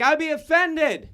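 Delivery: mock outrage.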